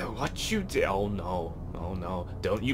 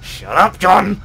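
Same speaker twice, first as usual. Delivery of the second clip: furious yell, much louder; angry shout; 6 semitones higher, 12 dB louder, slower than usual. Acted. Sayori screaming at John in anger. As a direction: scream it at him.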